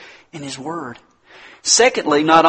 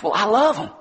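mock parent; 8 semitones higher, 3 dB louder than usual. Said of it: "neglectful parent quoted".

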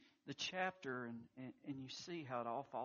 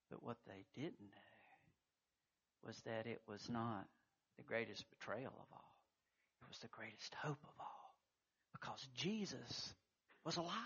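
hushed sermon voice; 2 semitones lower, 5 dB quieter than usual. Acted. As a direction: draw the congregation in quietly before the reveal. Hushed dramatic build.

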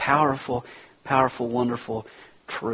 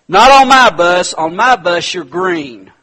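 much higher and louder, laying down the law; commanding shout; 7 semitones higher, 13 dB louder than usual. Retold or imitated. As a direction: boom it out like the boss of the bus. Driver lays down the rule.

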